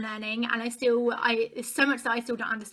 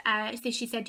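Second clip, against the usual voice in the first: ordinary talk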